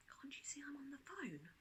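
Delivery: whispered brush-off from a busy parent; hushed. A narrator's reading. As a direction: whisper it, distracted and dismissive. Parent busy, shushing child.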